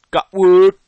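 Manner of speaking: loud happy yell; excited shout. Real, not acted. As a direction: cheer it out.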